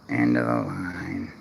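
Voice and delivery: very low, slow, dragged-out growl; Monsters Inc slug voice. Retold or imitated. Realtor mimics the city clerk as the Monsters Inc receptionist, low and slow. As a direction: drag it down low like a bored clerk. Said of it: "in Monsters, Inc, where she's like".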